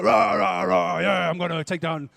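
hammed-up rah-rah battle yell; warrior battle cry. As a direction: rush in roaring like a fighter. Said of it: "we wanna run in and go".

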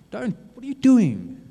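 sudden high, loud anguished cry; God crying out. Acted. God cries out as his child turns away, high loud alarm. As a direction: shout in alarm as a father watching his child fall. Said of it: "God is looking at you and saying".

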